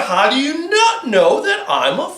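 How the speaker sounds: high indignant fox voice. Acted. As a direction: bristle like an offended fox. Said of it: "the fox got a little angry".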